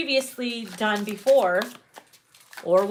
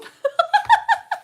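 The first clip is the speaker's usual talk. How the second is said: high squealing laugh; 4 dB louder than usual. Real, not acted. Cracking up.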